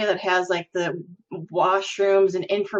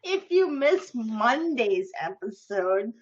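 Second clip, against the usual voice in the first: pointedly careful redo. Real, not acted. Redoes intro slowly after being teased.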